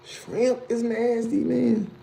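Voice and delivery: high, slow incredulous repeat; shocked disbelief at the comment. Real, not acted.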